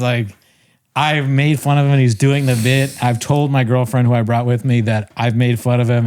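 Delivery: normal talking voice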